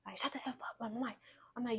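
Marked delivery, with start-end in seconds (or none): 0.2-0.8 hushed whispered curse; mock hushed "shut up"; retold whispered curse. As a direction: whisper it like his menace. Abuser's words retold in whisper.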